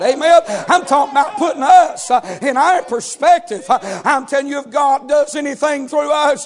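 preacher to congregation, building to a full shout; building preaching. Acted.

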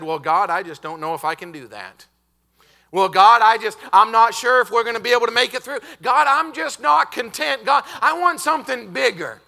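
complaining prayer. Retold or imitated. Preacher mimicking a doubter praying to God, hammed-up whine. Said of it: "we said".

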